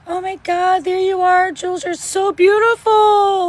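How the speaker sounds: high, adoring gush; gushing greeting. Retold or imitated. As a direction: gush at meeting her. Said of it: "I was like".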